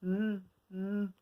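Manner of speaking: "M" hummed alone, twice; isolated sound. Shown as a demonstration. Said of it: "on va enlever le".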